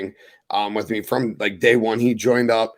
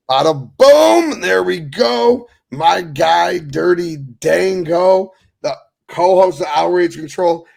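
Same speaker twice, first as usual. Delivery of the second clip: big, drawn-out show intro, higher and louder; hype introduction; 5 semitones higher, 6 dB louder, slower than usual. Real, not acted. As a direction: bring out your co-host like a ring announcer. Host introduces his co-host.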